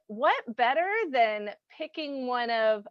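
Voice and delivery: normal talking voice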